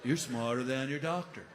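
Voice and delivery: lazy, amused hippie logic; stoner voice. Retold or imitated. Stoner explains.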